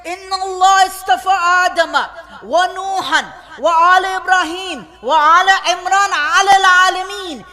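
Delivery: swelling from steady to loud, chanting the verse; rising sermon recitation. Acted. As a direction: build the recitation up like a majlis preacher. Preacher recites at rising force.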